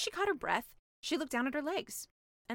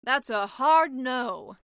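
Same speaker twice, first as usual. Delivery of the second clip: flat refusal; 8 dB louder, much slower than usual. Acted. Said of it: "said the sea witch".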